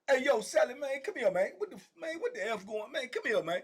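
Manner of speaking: high-pitched imitation of an urgent summons; calling out. Inmate calls his cellmate back from the door, calling out urgently. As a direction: mimic someone hollering a cellmate over.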